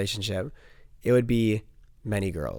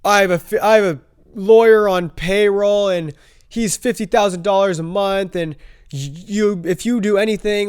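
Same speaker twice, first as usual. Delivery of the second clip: loud, higher mocking of his lawyer threat; 6 semitones higher, 5 dB louder than usual. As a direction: ham up the bragging friend. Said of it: "says to the girl".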